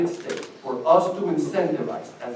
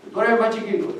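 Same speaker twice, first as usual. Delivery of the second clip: put-on recognizing old man, higher, louder; 9 semitones higher, 7 dB louder, much faster than usual. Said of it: "he comes over and says".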